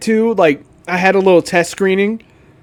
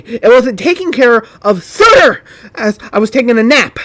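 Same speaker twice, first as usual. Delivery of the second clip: higher, hammed-up angry moderator voice; Nova's rant; 7 semitones higher, 3 dB louder than usual. Commentator reads Nova's post aloud as a higher mock rant. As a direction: rant like an edgy Discord mod.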